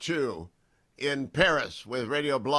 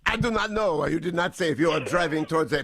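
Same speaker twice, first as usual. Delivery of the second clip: hammed-up French accent, slightly raised pitch; mock French accent; 4 semitones higher than usual. Host teasing the poet over his poem, mock French accent. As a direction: tease in a put-on French accent. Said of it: "Why did you not give us a French accent".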